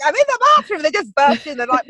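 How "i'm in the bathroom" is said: pitched way up, mock alarmed; high squeaky protest. Mum squealing at kids bursting into bathroom, high mock alarm.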